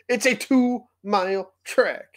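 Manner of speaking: high, slow, incredulous emphasis; exasperated rant. Real, not acted. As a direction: spell it out in exasperation. Exasperated point in his rant.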